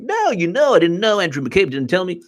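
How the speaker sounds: very high-pitched, hammed-up denial; mock Comey. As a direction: squeal out a mock excuse. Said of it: "Comey's just like".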